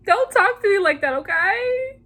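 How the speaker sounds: high-pitched, whiny indignation; hammed-up hurt offense; mock emotional outburst. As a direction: protest in a high voice, rising on okay. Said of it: "it's just like that times a thousand".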